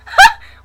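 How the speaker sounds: high loud laugh; burst of laughter. Real, not acted. Laughs at demon joke.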